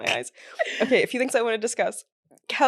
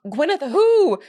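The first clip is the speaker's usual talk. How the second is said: mock snooty; 6 semitones higher, 4 dB louder than usual. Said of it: "And it's like".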